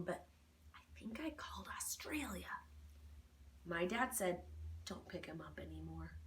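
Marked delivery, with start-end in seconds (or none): 0.0-2.5 sheepish whisper. A narrator's reading. Sheepish aside. 4.8-6.2 muttered quote. Retold or imitated. Dad's words muttered.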